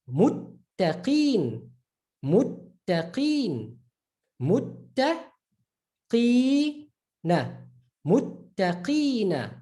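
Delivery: Muttaqeen, slow, the tt held; stressed doubled ta. Shown as a demonstration. Teacher drilling the doubled t slowly. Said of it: "The ta".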